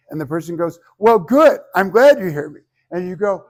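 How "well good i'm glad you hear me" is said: loud, high, acted-out huff; hammed-up quarrel voice, much louder; mock huffy. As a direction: play an upset partner grudgingly satisfied. Acts out a couple's quarrel.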